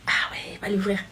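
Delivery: soft, excited whisper; hushed aside. Acted. Friend reacting to the doorbell before he comes in, whispered. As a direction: whisper it as the guest arrives.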